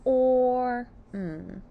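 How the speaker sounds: "or M." as two drawn-out sounds, held level; sounding out. Shown as a demonstration. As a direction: stretch "or", pause, then hum "M.". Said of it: "Sound out form".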